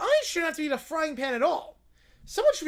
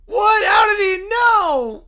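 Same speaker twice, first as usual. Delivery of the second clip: shocked shout; 5 semitones higher, 11 dB louder, slower than usual. Acted. Shocked at prank reveal.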